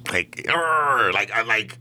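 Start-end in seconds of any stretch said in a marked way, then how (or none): 0.5-1.1 short frustrated growl, pitched up; retold angry grunt. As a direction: let out a mock angry arr.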